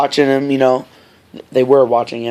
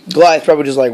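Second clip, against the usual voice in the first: ordinary talk, nothing marked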